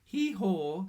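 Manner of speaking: loud, earnest hee-haw; serious little donkey; solemn bray. A narrator's reading. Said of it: "said Lottie solemnly".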